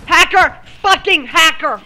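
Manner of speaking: angry shout. Real, not acted. Raging at cheater in game.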